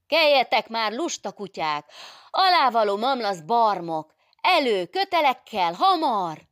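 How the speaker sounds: high, loud scolding yell; furious shouted orders; angry rousing shout. Acted. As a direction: bawl insults to get them moving. Adél berates the sleeping workers.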